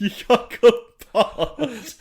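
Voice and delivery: high, loud, drawn-out teasing through laughter; laughing mockery. Real, not acted. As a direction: crow it slowly while laughing. Teasing friend over being called posh.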